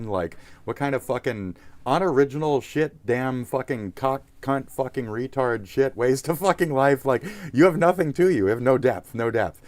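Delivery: heated, rising tirade; angry rant. Real, not acted. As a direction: build from exasperation to loud, high scolding.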